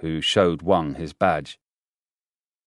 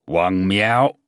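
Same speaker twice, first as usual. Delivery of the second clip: louder, brusque address by name; blunt, impolite; 5 dB louder than usual. A narrator's reading. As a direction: call him out by name, curtly and rudely. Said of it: "so direct and impolite".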